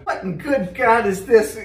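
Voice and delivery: laughing disbelief. Real, not acted. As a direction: talk through the giggles.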